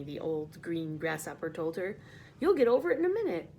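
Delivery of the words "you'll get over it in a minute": fast, higher, louder brisk comfort; brisk reassurance. Acted. Grasshopper reassures the giddy ladybug, fast and brisk. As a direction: brush off her worry quickly.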